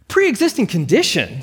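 high, disbelieving question; incredulous. A narrator's reading. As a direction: echo "Pre-existing condition?" as if it were absurd. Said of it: "Sarah asked incredulously".

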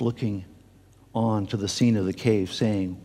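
ordinary talk

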